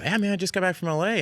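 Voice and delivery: breezy Californian bragging; laid-back LA dude. Retold or imitated. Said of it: "a bit that Fred and Keenan and I used to do".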